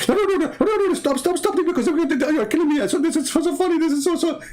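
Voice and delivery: very high squeaky laughing voice; turkey voice. Pretend. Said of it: "became like a turkey".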